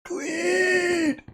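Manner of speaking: very high yelled cry, strained and stretched out; Lemoyne voice shout. Pretend. Streamer performing a chat request, very high shouted cry. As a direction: bellow it like a soldier calling from far off. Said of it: "in Lemoyne voice".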